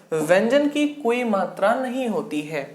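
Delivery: usual speaking voice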